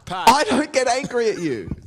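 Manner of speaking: high, slow insistent denial; heated denial. Acted. Denying to his co-host that he gets angry, slow and high. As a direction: insist through the noise.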